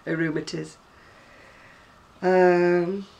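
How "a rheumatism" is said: a rheumatiz, ROO-ma-tiz, the ism dropped; mum's rheumatiz. Retold or imitated. Vlogger repeats her mum's northern word, a rheumatiz. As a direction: say a rheumatiz like an old Manchester mum. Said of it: "as my mum used to call it".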